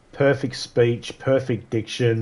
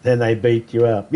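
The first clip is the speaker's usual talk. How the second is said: normal talking voice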